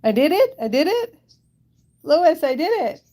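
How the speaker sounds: high, delighted cries of success; excited, pitched well up; triumphant. Real, not acted. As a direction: crow over finally getting it. Celebrates finally solving the word puzzle.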